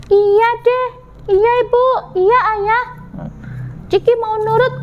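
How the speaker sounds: put-on high, childlike voice; obedient little chick. Ciki agrees to obey parents, high child voice. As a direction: answer high and dutifully like a small child.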